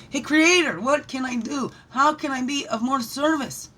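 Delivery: high, loud call upward. Acted. Calling out to Creator.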